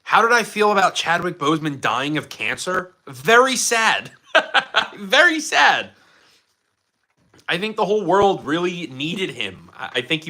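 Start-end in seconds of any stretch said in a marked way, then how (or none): 3.1-4.1 mock solemn. Then laughs, repeats. 4.3-5.9 high, laughing through the words; can't keep a straight face; giggling very sad. Real, not acted. Laughing repeat.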